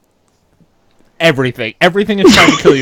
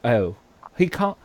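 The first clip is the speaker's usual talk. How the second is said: ordinary delivery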